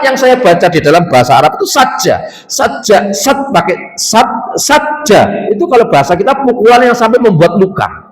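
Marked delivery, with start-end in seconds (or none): none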